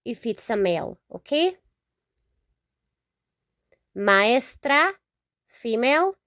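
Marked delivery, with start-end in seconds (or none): 4.0-4.9 Maestra with ma-es split, tapped r; teacher modelling Maestra; Spanish pronunciation. Shown as a demonstration. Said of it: "here we say maestra".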